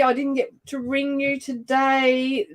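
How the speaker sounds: ordinary talk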